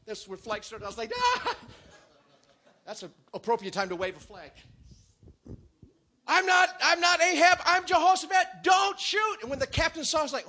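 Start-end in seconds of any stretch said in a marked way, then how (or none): 1.2-1.6 high, loud comic "ah"; mock panicked yell. Joking about how flag-waving started, a panicked yelp. 6.3-9.4 high, loud, desperate shouting; panicked pleading. Acted. Jehoshaphat telling archers he isn't Ahab, pleading in panic.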